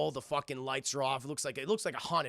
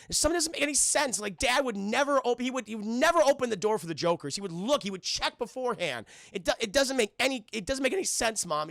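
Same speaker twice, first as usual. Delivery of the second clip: higher, louder, insistent; upset protest; 9 semitones higher, 5 dB louder than usual. Acted. Upset son arguing to his mom about Dad, distressed insistence.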